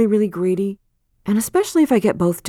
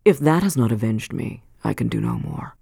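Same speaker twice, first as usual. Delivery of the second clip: put-on cold old man; 6 semitones lower than usual. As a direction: let it sink low and cold. Chillingworth's cold answer.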